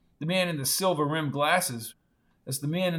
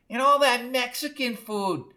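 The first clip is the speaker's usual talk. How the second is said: high voice with the nose pinched; high-pitched complaint through a pinched nose; nose held; 9 semitones higher than usual. A narrator's reading. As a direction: say it as if holding your nose. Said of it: "holding her nose".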